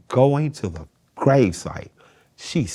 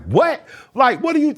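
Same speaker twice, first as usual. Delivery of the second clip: indignant; 7 dB louder than usual. Retold or imitated. Retold outburst at grandma.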